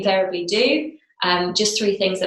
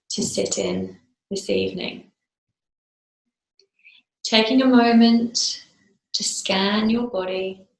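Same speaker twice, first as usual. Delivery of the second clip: slow meditation voice with long pauses; guided meditation. Real, not acted. Teacher settling a class into breath meditation, slow and spaced. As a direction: guide them gently into stillness, phrase by phrase.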